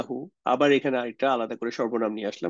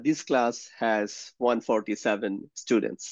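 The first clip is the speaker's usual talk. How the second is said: awkward wrong sentence; 3 semitones lower, 3 dB quieter, much slower than usual. Shown as a demonstration. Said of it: "It sounds very weird".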